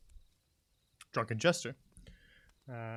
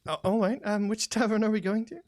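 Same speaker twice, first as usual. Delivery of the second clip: higher-pitched, slightly flustered agreement; pitched up, a little hesitant; hesitant character; 8 semitones higher, 3 dB louder than usual. Acted. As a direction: play her obliging and tentative.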